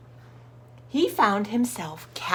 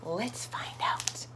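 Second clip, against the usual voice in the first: whisper; 10 dB quieter, slower than usual. Real, not acted. Reader teases turning the page.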